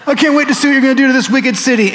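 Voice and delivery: high, mocking voice with a sneering laugh; hammed-up bitter prophet, gloating over the city; sarcastic pouting Jonah. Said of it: "arms folded".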